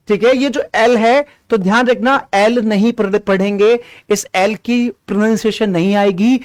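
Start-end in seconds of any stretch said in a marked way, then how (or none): none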